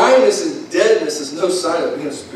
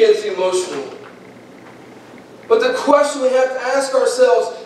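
full-force preaching; 9 semitones higher than usual. Acted. Preacher pleads with the congregation, urgent and raised.

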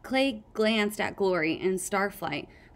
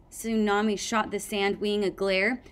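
ordinary delivery, nothing marked

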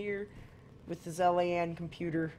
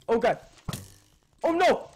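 much higher, louder panicked cries; real panic; 11 semitones higher, 10 dB louder, much slower than usual. Real, not acted. Gamer watching himself die, panicked high cries. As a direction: cry out as death comes.

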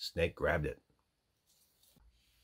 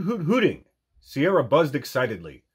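usual speaking voice

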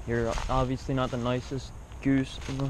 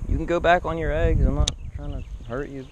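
higher, sing-song coaxing to the bird; coaxing goose; 5 semitones higher than usual. Real, not acted. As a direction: sweet-talk the goose back onto its eggs.

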